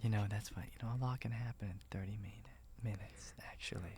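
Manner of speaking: fading soft whisper; ASMR whisper. Pretend. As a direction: whisper it down to nothing. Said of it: "do A S M R the entire time".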